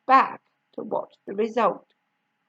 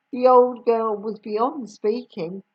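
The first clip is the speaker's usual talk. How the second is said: plain reading voice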